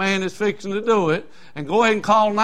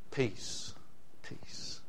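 hushed peace; 7 semitones lower, 17 dB quieter than usual. Retold or imitated. Mocking hippie peace greeting.

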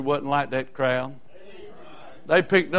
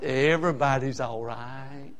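soft mocking reassurance; 5 semitones lower, 6 dB quieter, slower than usual. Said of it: "this guy that comes along and says".

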